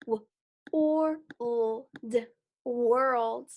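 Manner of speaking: "W-, or-, l-, d-" tapped apart, then "world" blended; sounds tapped out. Shown as a demonstration. Teacher tapping out sounds for the class. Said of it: "Let's try it and tap it out".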